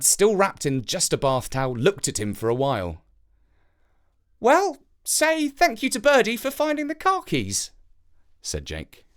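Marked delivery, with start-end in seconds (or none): 4.4-7.7 put-on high boy's voice; a boy telling the crow about his dad, in a high child's voice; young boy. A narrator's reading. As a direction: speak like a small boy explaining.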